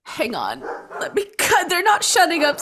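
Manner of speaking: usual speaking voice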